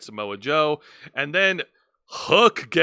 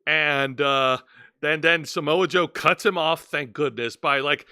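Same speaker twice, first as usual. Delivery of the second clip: usual speaking voice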